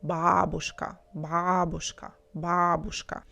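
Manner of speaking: Бабушка repeated, each stressed on the first syllable; correct stress. Shown as a demonstration. Teacher drilling natural Russian, Бабушка stressed on the first syllable. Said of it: "pronounce it with the correct stress".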